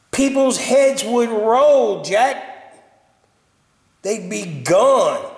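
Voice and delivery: mad rant. Real, not acted. Man ranting to his camera about bad props, angry. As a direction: scold them like you're fed up. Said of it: "Does it sound like I'm mad".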